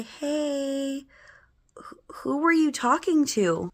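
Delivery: slow, high, half-whispered question; careful, hushed big-sister voice; gentle coaxing. Retold or imitated. Gently questioning frightened little brother.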